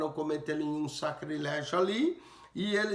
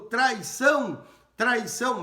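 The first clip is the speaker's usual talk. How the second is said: higher, louder mock outcry; retold cry of treason; 6 semitones higher, 5 dB louder than usual. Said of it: "rasgou e gritou".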